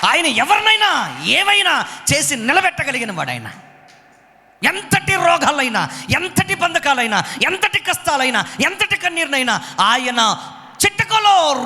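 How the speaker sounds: high, driving pulpit voice; fiery preaching. Acted. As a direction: preach it hard to the congregation. Preaching at full force. (ఉత్సాహంగా బోధించడం)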